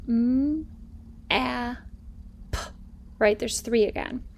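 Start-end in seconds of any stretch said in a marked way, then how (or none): 0.1-2.6 m, a, p one by one; segmented map. Shown as a demonstration. Teacher segmenting map. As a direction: sound out each sound. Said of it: "how many sounds".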